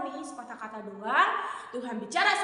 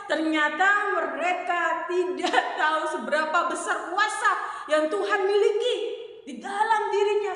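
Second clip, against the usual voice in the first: ordinary talk, nothing marked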